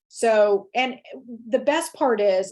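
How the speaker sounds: usual speaking voice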